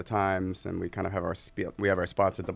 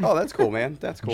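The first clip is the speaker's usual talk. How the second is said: higher, breezy fake interest; mock polite; 6 semitones higher, 3 dB louder than usual. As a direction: brush him off politely.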